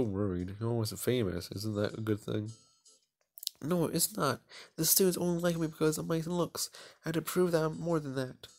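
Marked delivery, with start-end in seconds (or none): 3.6-8.5 put-on higher, proud girl bragging; proud schoolgirl. Popular girl lecturing her classmate, higher and proud. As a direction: puff yourself up as the popular girl. Isabella insisting she is more.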